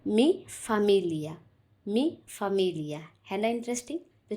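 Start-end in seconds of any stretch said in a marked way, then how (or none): 0.1-1.3 "Mi Familia" said very slowly, each syllable drawn out clearly; teacher modelling "Mi Familia" at half speed; slow repeat-after-me demo. Shown as a demonstration. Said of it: "तो बोलिए मेरे साथ". 1.9-3.0 "Mi Familia" said very slowly, each syllable drawn out clearly; teacher modelling "Mi Familia" at half speed; slow repeat-after-me demo. Shown as a demonstration. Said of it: "तो बोलिए मेरे साथ".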